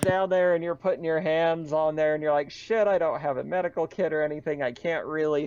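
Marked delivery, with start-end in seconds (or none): none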